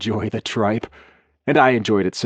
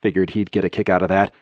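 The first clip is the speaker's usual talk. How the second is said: usual speaking voice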